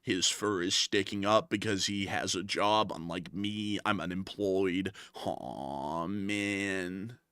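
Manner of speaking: low, soft, slow sulking critter voice; mopey marmot. Acted. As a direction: drag it out like a sad unemployed marmot. Marmot mourns being unemployed.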